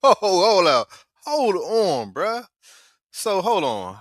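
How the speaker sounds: high, loud, stunned interjection; incredulous interruption. Real, not acted. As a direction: cut in loudly, stopping everything in disbelief. Listener reacts to the huge payoff.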